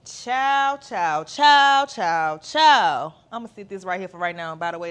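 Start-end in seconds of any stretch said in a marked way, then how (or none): 0.0-3.1 loud, high chanted cheers; mock toast. Host toasting her viewers with water, loud chanted cheers. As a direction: shout the cheers like raising a glass.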